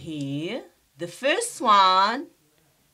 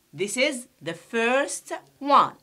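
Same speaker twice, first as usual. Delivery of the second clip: ordinary talk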